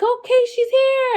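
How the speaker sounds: high excited nurse voice; nurse quoted. Retold or imitated. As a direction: announce it brightly. Nurses announce baby's arrival.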